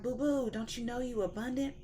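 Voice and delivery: God voice, sweet and high. Retold or imitated. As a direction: call her boo boo, lifted and pleading. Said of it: "he was like".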